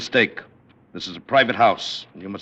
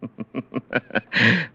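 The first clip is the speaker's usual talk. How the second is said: amused laugh; 3 semitones higher than usual. Acted. President laughs at the threat.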